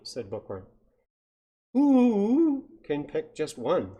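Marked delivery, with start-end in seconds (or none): 1.7-4.0 long high, loud hoot on "Who"; hooting owl. Acted. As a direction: stretch "Who" into an owl's hoot. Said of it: "said the owl".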